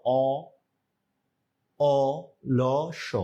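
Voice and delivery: অ, অ, ল, স spelled out slowly, one sound at a time; syllable by syllable. Shown as a demonstration. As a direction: sound out অলস letter by letter for the class. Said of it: "সবাই উচ্চারণ করেন তো".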